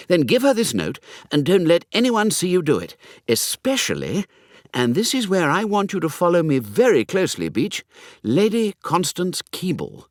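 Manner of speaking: lightly voiced young toff, urgent and conspiratorial; eager young Hugo.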